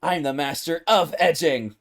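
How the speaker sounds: mock boast. Character boasting of his mastery, loud and grandiose. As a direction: proclaim it proudly, hitting each phrase.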